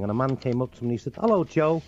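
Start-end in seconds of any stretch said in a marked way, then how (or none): 1.1-1.8 Scouse greeting, pitched up; Liverpool accent. Acted. Liverpool man coming up to the comedian, Scouse accent. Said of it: "They have their own way of talking".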